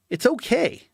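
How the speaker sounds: mocking the world's indifference; mock shrug. Speaker mocks the world saying it's okay, sarcastic high voice.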